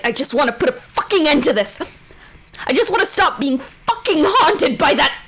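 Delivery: furious mother. Acted. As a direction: tear into her, building to a yell. Mother rages at the witch.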